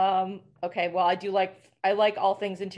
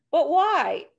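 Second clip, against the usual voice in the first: put-on higher, louder whiny kid's why; nagging toddler; 6 semitones higher, 6 dB louder than usual. Toddler pestering mom at the fair, mock whiny and louder. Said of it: "the two-year-old kid who goes to the state fair".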